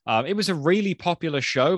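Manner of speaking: normal talking voice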